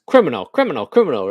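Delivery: loud chanting robotic warning; robot car alarm voice. Retold or imitated. Car voice chanting at the passenger, loud mock alert. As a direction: mimic the car flagging you, loud. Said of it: "And then it goes".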